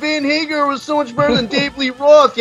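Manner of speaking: mock him like a complaining kid; whiny mock Sammy. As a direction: parody of a fan's whine. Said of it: "always fucking bitching about how".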